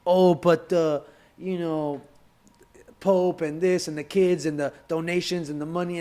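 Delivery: hammed-up parody of people scoffing at church; mocking critics.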